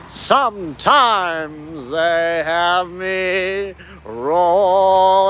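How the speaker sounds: very slow, grand and stretched out; slow showman drawl. Acted. Describing the song and dance they make him do, slow showman. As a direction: roll it out like a ringmaster.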